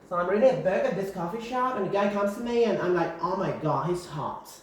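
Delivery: high, quick American gossip voice; chatty put-on American accent. Shown as a demonstration. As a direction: launch into a dramatic anecdote in an American accent, high then falling. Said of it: "I'm using sort of American accent".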